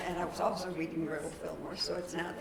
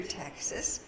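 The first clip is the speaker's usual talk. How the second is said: ordinary talk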